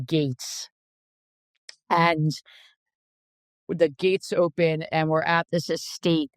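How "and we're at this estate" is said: normal talking voice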